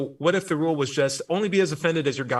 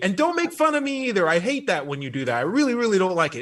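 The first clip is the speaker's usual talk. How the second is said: put-on petulant child God; 8 semitones higher, 4 dB louder than usual. Host mocks a sensitive God as a toddler, petulant. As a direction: whine it like an oversensitive three-year-old. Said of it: "It's like a thirty-year-old, a three-year-old".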